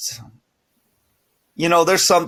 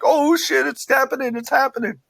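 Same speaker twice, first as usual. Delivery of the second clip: excited; 12 semitones higher than usual. Retold or imitated. Excited fan reaction retold.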